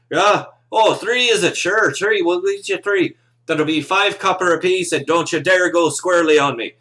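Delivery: loud, high Irish tavern keeper accent. Shown as a demonstration. Game master voicing Justin the bartender for the players, in a loud, high Irish accent. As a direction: lay the Irish brogue on thick and loud. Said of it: "throw on a, an Irish accent and be like".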